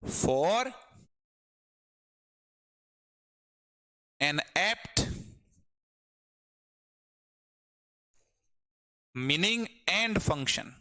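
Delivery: slow, word by word dictation. Shown as a demonstration. Teacher dictating a definition slowly to the class. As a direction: spell it out slowly for students. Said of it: "simple सी बात है".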